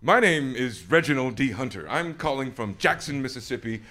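loud, over-eager formal phone introduction, faltering later; nervous, geeked caller. Retold or imitated. Geeked fan phoning a real British person, nervous and loud. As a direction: act out a starstruck caller getting tangled in the query. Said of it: "so nervous and so geeked to speak to an actual British person".

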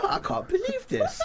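high laughing disbelief; surprised. Retold or imitated. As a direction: squeal it in disbelief. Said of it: "He's like".

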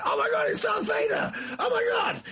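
very high, frantic fanboy squeal; shrieky mock freak-out; screaming fan. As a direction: scream inwardly with hammed-up excitement. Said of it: "Your head is screaming".

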